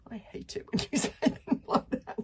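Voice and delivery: whimpering through a nervous laugh; half-laughing, half-whining in fear. Acted. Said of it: "whimpered Fishlegs".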